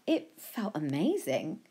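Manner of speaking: usual speaking voice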